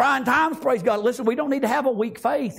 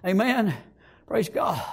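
ordinary delivery

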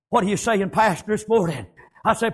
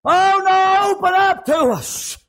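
high, loud cries for help; preacher's acted desperate wail; agonized screaming; 10 semitones higher, 4 dB louder, slower than usual. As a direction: holler it like the drowning begging to be let in. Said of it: "screaming and agony".

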